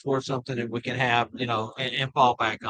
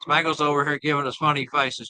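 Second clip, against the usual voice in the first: ordinary talk, nothing marked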